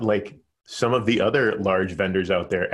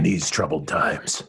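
movie announcer; 3 semitones lower than usual. Pretend. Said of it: "need to get the movie announcer voice going".

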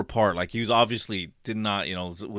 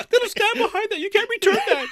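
much higher, louder mock yell; imitating the crazed customer; mock screaming; 7 dB louder than usual. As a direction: scream over the bubble gum. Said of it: "Don't buy it".

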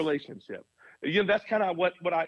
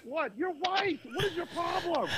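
much higher, incredulous retort; baffled board members; 13 semitones higher, 4 dB quieter than usual. Retold or imitated. Board members answering the pastor, incredulous and much higher. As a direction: toss the question back in disbelief. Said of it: "And they're like".

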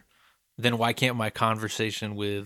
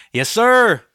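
loud, high churchgoer affirming the preacher; shouted amen-style response; congregation call-out; 12 semitones higher, 11 dB louder than usual. Retold or imitated. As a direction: call back to the pulpit. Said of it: "congregation to just be like".

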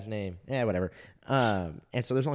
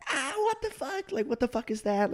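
mimicked class screaming. Whole class reacting to a slide, mimicked screaming. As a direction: imitate a roomful of shrieking students, high without full volume. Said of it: "the whole class starts screaming".

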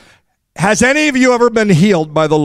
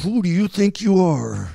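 a touch of anger, softer; mock anger; 6 dB quieter than usual. Shown as a demonstration. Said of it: "Now say it with anger".

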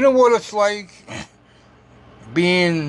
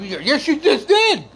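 laughing retort; 8 semitones higher than usual. Retold or imitated. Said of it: "I said".

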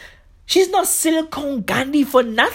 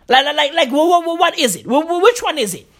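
usual speaking voice

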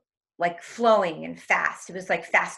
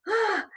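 high gasp; 13 semitones higher than usual. Retold or imitated. Gasp in retelling.

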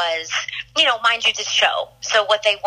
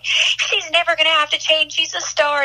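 Katie defending her; 9 semitones higher than usual. Retold or imitated. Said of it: "she was just like".

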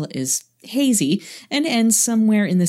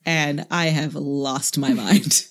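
normal talking voice

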